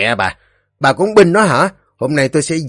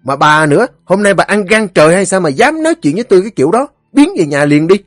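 high, loud, fast retort; angry husband; 8 semitones higher, 6 dB louder, faster than usual. A narrator's reading. Husband orders his meddling wife home, angry. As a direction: bluster and order her off, raised voice.

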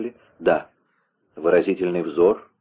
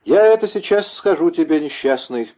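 high, forceful threat; menacing outburst; 9 semitones higher than usual. A narrator's reading. False monk about to reveal himself, menacing high outburst. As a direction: launch it high, easing down on the last word.

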